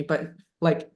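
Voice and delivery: ordinary delivery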